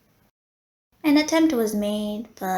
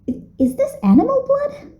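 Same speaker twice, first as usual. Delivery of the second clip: much higher, loud horrified question; stammered shock; 9 dB louder than usual. Acted. Receptionist horrified by the donation, stammering high and loud. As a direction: blurt it out in disbelief.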